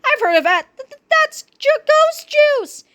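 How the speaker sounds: high, stuttering panic; stammering fright. A narrator's reading. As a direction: stammer it, freaked out. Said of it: "cried Bug".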